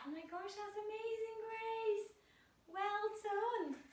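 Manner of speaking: high, bright praise; delighted high praise for Grace; excited praise. Real, not acted. Praising child's parrot pose.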